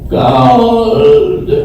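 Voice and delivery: bursts out the answer with a laugh; high, loud and laughing; excited exclamation. Real, not acted. Excited answer before group laughter.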